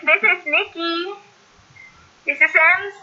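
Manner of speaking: robotic glitchy video call audio. Real, not acted. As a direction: voice garbled like a robot over a bad call. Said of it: "tunog robot yung dalawa".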